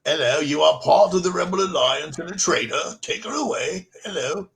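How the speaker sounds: put-on playful, clumsy British accent; mock British accent. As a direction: ham up a British accent as a stormtrooper-ish officer. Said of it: "I can't do a British accent".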